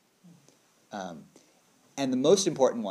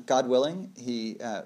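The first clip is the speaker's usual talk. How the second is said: usual speaking voice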